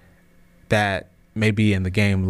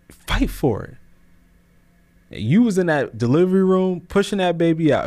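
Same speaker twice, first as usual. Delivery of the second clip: higher and rising, pressing every line home; fired-up preaching; 7 semitones higher than usual. Real, not acted. Podcast host urging listeners not to quit, fired-up preaching. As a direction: preach it, building to the cry of fight for it.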